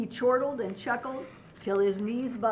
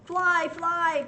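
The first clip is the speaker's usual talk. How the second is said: loud high cry of warning; calling out a warning; 8 semitones higher, 7 dB louder than usual. Acted. Birds warning the bees, loud high call. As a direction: shout it high so all can hear.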